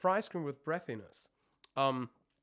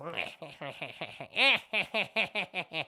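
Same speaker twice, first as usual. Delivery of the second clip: loud cackling witch laugh; nasty witch laugh; 3 semitones higher, 4 dB louder than usual. Shown as a demonstration. Coach demonstrating the witch laugh for twang. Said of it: "a real nasty laugh".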